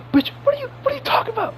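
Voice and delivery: high and fast, cracking in disbelief; incredulous outburst. Real, not acted. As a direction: fire it off, squeaking with disbelief. Exasperated rant about MJ.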